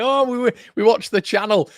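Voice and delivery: mock sheepish fan. Imitating fans explaining themselves.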